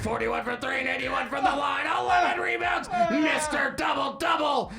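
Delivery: building arena announcer, ending long. Acted. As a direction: build up to the big name. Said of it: "You would not fucking do that".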